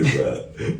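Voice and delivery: usual speaking voice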